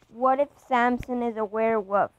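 loud sudden confession; blurted out. Acted. Pushed to explain, he finally blurts it out loud. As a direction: burst out with it.

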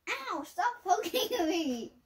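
laughing, high, loud protest; exasperated poked game character. Real, not acted. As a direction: whine through laughter at being poked. Being poked by Olivia in game.

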